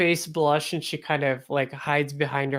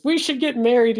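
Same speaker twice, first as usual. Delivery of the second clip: eager proposal; 8 semitones higher, 6 dB louder, faster than usual. Acted. Feng Jin proposes.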